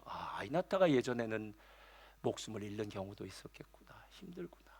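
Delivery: whispered inner realization; whispered thought. Real, not acted. Pastor realizing childbirth's danger, whispering to himself. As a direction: breathe it out, no voice.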